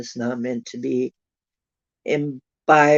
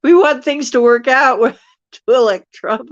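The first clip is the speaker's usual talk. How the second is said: laughing; 9 semitones higher, 8 dB louder than usual. Real, not acted. Laughing through a joke.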